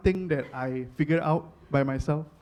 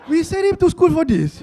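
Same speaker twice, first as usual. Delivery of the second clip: put-on high, loud disappointed parent in Malay accent; 11 semitones higher, 6 dB louder than usual. Parents disappointed in their comedian son, high Malay-accented complaint. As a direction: wail it in a mock Malay accent. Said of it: "I gave a Malay accent to my Indian parents".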